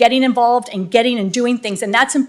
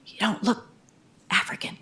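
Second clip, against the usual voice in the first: hushed aside; 11 dB quieter than usual. Retold or imitated. People telling her she doesn't look African.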